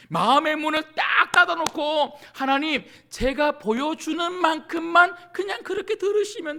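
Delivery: loud, high, impassioned rebuke; fervent preacher crying out to the congregation; full-force preaching. Acted. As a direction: preach it hard, building to a shout. Preaching at full force, acted prayer.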